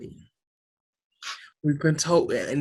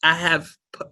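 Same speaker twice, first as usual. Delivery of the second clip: ordinary talk, nothing marked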